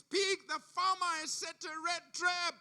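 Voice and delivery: high, loud and slow, pleading; urgent warning. Acted. Rat begging the pig to listen, high and urgent.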